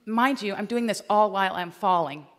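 usual speaking voice

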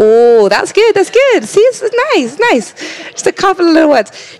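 high-pitched, playful mock British accent. Preacher teasing the congregation for their repetition, mock British accent. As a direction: tease with a hammed-up British lilt. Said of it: "let's say it with a British accent".

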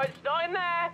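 high-pitched, flustered denial; frantic denial. Acted. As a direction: protest in a high, rising panic. Searching the drawer, sudden frantic denial.

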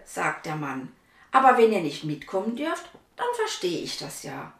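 put-on lively read-aloud voice for children; story narration. A narrator's reading. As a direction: tell the story to little listeners. (Vorlesestimme für Kinder)

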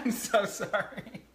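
laughing apology. Real, not acted. Apologizing after breaking bowl.

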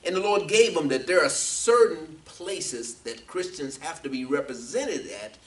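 forceful preaching with stretched key words; emphatic preaching. Real, not acted. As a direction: drive each phrase home to the congregation.

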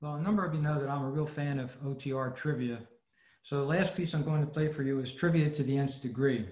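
ordinary talk, nothing marked

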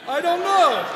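loud frustrated I don't know; exasperated shout. Acted. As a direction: shout it out, fed up. Shouted punchline.

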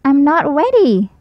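a little owl stalling; child owl voice. A narrator's reading. Baby Owl voiced in story.

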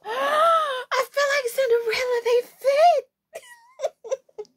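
high, slow squeal after a gasp; overjoyed squeal. Real, not acted. Vlogger trying on shoes that fit, overjoyed squeal. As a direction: squeal in fairy-tale delight.

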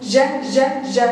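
ج as a short separate syllable, repeated; first sound isolated. Shown as a demonstration. Teacher answering what the first sound is, sound isolated. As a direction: sound out ج by itself, leaving gaps between repeats. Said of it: "الصوت الأول اللي أنا بقوله".

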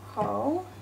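ordinary talk, nothing marked

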